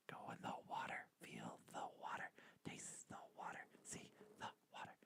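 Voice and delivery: breathy whisper, spooky and silly. Pretend. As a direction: whisper it like a weird chant. Goofing around, whispering silly lines.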